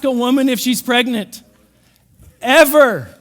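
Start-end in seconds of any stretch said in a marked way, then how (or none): 2.4-3.1 loud, higher Ever with a laugh; laughing punch. Acted. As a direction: slam the last Ever with a laugh.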